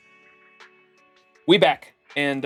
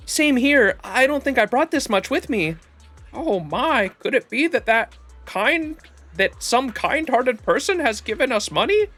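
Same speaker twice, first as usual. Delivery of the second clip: high character voice; 8 semitones higher than usual. Acted.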